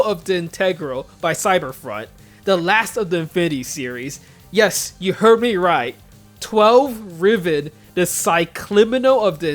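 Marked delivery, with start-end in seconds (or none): none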